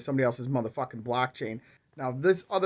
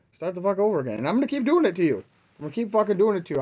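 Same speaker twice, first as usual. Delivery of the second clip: higher and fast, hammering the threat; furious threat; 6 semitones higher, faster than usual. Real, not acted. Vlogger threatening the scammer he will keep exposing him, fast high furious threat. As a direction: promise relentless payback.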